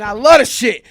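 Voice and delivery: loud, exultant mock outburst; retold defiant shout. Inmate taunting those beating him, loud retold defiance. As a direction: crow it loudly at your attackers.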